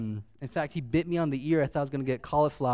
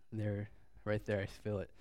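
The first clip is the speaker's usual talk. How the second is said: real catch in the throat, much softer; choked up; 8 semitones lower, 13 dB quieter than usual. Real, not acted. Telling how loved he is by his wife, choked up quietly. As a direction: let the emotion close the throat. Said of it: "I choke up a little bit".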